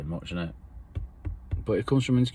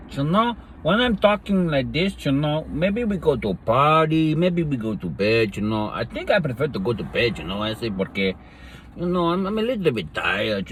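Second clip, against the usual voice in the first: high, put-on Spanish accent; 5 semitones higher than usual. Doing accents for the baby in a high, hammed-up Spanish accent. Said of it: "I do the accents".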